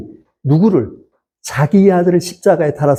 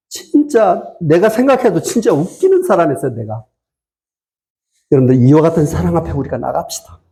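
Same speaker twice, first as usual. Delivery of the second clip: normal talking voice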